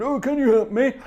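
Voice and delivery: high-pitched, put-on plea for help; father-in-law impression. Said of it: "That was just my impression".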